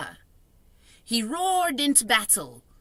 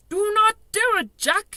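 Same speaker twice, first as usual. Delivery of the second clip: high, loud screech; 13 semitones higher, 8 dB louder than usual. A narrator's reading. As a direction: screech in panic. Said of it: "he screeched".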